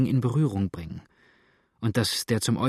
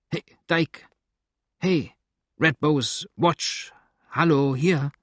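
raised, higher-pitched calls; calling dogs; 5 semitones higher, 4 dB louder, slower than usual. A narrator's reading. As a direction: call out to the dogs one name at a time.

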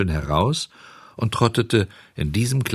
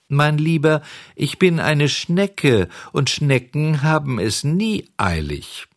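unhurried snail, pitched up and drawn out; slow and leisurely; 6 semitones higher than usual. A narrator's reading. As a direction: stretch the words out, in no rush at all. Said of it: "So langsam und gemächlich wie zuvor".

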